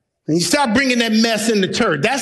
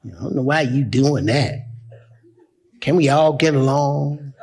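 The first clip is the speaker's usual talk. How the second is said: lower, sing-song mock of worldly believers; mocking the soft crowd; 6 semitones lower than usual. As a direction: imitate them like a whining crowd. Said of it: "Today's people would say".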